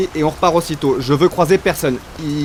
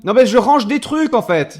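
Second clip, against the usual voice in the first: higher, louder defensive call; raised voice, caught out and defensive; calling out through a shutter; 8 semitones higher, 5 dB louder than usual. Acted. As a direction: shout an excuse through the metal door. Talking through shop shutter.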